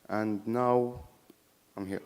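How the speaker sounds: usual speaking voice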